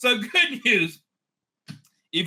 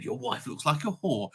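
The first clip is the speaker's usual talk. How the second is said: quiet aside; 7 semitones lower, 7 dB quieter than usual. Retold or imitated. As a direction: toss the insult off quietly.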